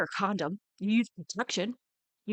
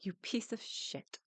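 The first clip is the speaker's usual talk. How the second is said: whispered insult; 2 semitones higher, 11 dB quieter than usual. Real, not acted. Reacting to the documentary, she whispers the insult. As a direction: hiss it under your breath. Muttered insult at absent man.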